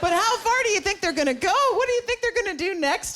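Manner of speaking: high, rising mock alarm; mock outrage. Real, not acted. Comic pitches her voice up asking the crowd what's next. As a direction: pitch it up like a scandalised gossip.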